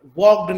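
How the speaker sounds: वॉक्ड ending in a hard voiced "d". Shown as a demonstration. Teacher showing what not to say, वॉक्ड with a loud voiced d. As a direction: punch out वॉक्ड with a d at the end. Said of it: "ये नहीं कि हम ड की तरह pronounce करेंगे".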